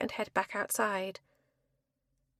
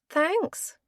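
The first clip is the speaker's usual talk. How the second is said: dry sarcastic thanks; 6 semitones higher than usual. Acted. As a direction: say it with a note of sarcasm. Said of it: "with a note of sarcasm".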